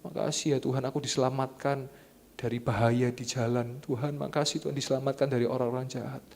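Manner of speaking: soft, low acted prayer of thanks; hushed, mildly weepy mock prayer; tearful prayer. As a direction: imitate a quietly tearful thank-you to God. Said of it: "Merem nangis".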